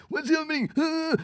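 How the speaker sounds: very high whiny fretting; whiny panicky mock. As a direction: whine it like everything is falling apart. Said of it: "and getting all pissy".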